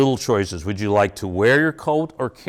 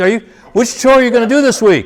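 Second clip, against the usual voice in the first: laughing; 10 semitones higher, 8 dB louder, faster than usual. Acted. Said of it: "I'm still waiting for the defiant kid".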